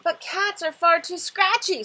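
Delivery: loud, high dragon yelp. Acted. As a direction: cry it out in protest. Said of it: "said the dragon with a yelp".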